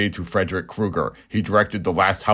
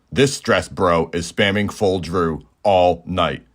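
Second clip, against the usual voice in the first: normal talking voice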